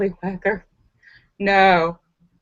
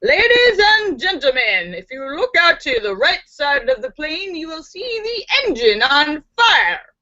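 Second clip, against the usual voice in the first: singsong, lifted pilot patter; airline pilot announcement; 4 semitones higher than usual. Acted. Pilot addresses the passengers, in announcement singsong. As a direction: announce it brightly over the cabin speakers. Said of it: "heard the pilot's voice say".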